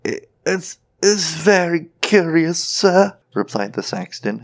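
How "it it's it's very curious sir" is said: high, stammering, frightened voice; terrified sexton. A narrator's reading. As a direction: stammer politely, half dead with fright. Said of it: "half dead with fright".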